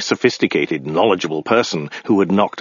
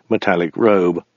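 usual speaking voice

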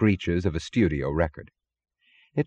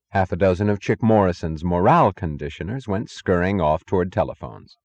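plain reading voice

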